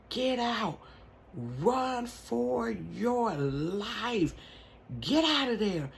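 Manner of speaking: high urgent aunt's warning, held back from a full yell; aunt warning her niece to get out, urgent but restrained. Retold or imitated. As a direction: urge her to run, but keep the volume down. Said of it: "She yelled".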